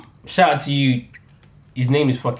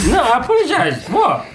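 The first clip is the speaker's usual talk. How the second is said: laughing apology; 10 semitones higher than usual. Real, not acted. Laughing apology after joke.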